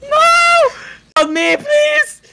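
panicked high screaming; being chased. Real, not acted. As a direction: shriek in terror while fleeing. Monster chasing him in game.

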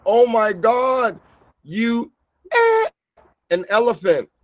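drawn-out, high-pitched pretend holler; hammed-up yell without full volume; mock yelling. Yelling an insult across a food court, mock yelling. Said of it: "yelling at the top of my lungs".

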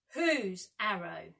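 slow, stern demand; sternly. A narrator's reading. Peter sternly asks the boys whose arrow it is, slowly. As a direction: press for an answer slowly. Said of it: "he asked sternly".

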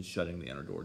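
ordinary delivery

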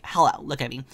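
normal talking voice

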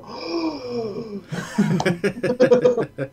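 high, laughing mock gasp. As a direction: gasp in fake shock. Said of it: "And I go".

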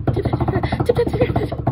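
rapid-fire loud tapping; fast hoofsteps. Acted. Little goat races to the other side, quick hoofsteps. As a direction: rattle it off like scampering little hooves. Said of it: "went as fast as he could".

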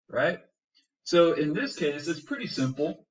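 normal talking voice

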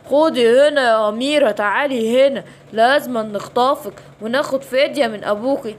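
ordinary talk, nothing marked

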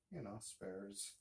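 ordinary delivery

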